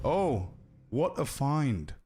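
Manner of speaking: slow, deadpan "what a find"; boring way. Shown as a demonstration. Streamer mimicking the bland character line, deadpan. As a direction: say "what a find" flat, slowly, unimpressed. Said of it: "and the boring way".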